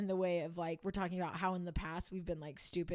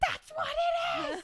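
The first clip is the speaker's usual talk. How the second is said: much higher, louder, slow shocked cry; shocked roommate; 9 dB louder, slower than usual. Retold or imitated. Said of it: "And she was like".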